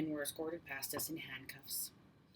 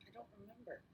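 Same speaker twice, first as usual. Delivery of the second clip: hesitant and much softer, almost swallowed; slow, hesitant; 3 semitones higher, 16 dB quieter, faster than usual. A narrator's reading. Scared girl answering the detective, hesitant and soft. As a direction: let it fall away, barely voiced. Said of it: "I said slowly".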